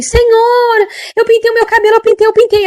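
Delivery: put-on anxious old lady. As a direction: plead like a frightened elderly woman confessing. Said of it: "Ela falou assim".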